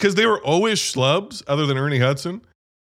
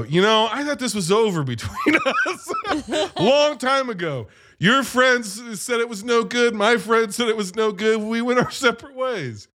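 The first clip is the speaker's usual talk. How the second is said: higher-pitched mock-hurt ex; mock breakup speech; 7 semitones higher than usual. Venkman talking to Gozer like an old flame, mock hurt. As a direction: play a wounded ex, half laughing.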